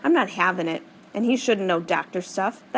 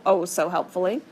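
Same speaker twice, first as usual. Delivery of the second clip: usual speaking voice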